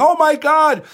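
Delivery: high, loud mocking impression of a panicked official; mocking quote. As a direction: mimic her alarm, hammed up. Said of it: "that idiot Judy Persicilli saying".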